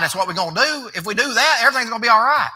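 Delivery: rising pulpit preaching. Real, not acted. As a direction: lift the congregation with fervor. Preaching crescendo.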